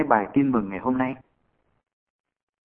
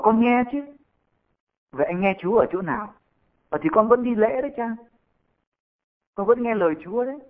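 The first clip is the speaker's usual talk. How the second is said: higher, defensive husband protesting to the priest; a hint of a husband insisting he listens; lightly voiced husband; 8 semitones higher than usual. (giọng người chồng cao hơn, chống chế nhẹ)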